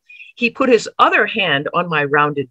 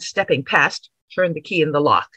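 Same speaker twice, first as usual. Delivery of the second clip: ordinary delivery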